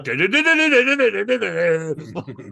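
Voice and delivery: slow honking horn noise, high and loud; imitated horn honking. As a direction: blare it like limo horns. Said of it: "honking horns going".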